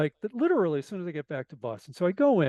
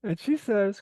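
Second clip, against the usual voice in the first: normal talking voice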